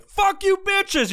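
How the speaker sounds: high, loud, slow mock yell; mock road rage. Cursing at rubberneckers from the car, high, loud and slow. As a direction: drag it out like a furious driver.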